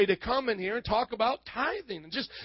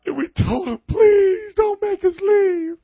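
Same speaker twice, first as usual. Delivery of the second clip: a hammed-up Southern cry, much higher and louder, slow; mock-sobbing plea in a Southern accent; Southern sob story wail; 10 semitones higher, 12 dB louder, slower than usual. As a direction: beg like a melodramatic Southern victim. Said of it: "They became Southern".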